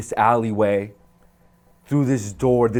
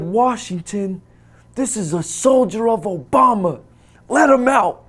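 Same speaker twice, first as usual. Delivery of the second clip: loud, much higher, triumphant; excited order; 10 semitones higher, 6 dB louder than usual. Retold or imitated. Guard orders the American freed. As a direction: proclaim the release.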